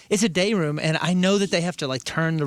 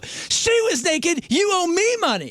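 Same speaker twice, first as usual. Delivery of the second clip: high, drawn-out mock-outraged shout; retold scream; 14 semitones higher, 3 dB louder, slower than usual. Guest to front desk demanding money, screaming. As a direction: holler it slowly across the lobby. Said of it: "I screamed in, in the lobby".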